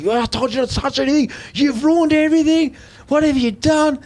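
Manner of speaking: high-pitched, fast furious rant; angry dad. Retold or imitated. Father attacks his son over the changed shop, high angry rant. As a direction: tear into the son for touching everything. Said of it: "he's immediately gone to attack".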